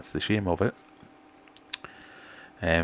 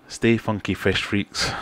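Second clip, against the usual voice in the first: put-on sheepish try at Aquafunk's accent, louder; mock Aquafunk accent; 3 semitones higher, 4 dB louder, slower than usual. Streamer echoing a viewer's catchphrase in a mock Aquafunk accent. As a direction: sign off like Aquafunk does, in his accent. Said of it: "I can't do the accent".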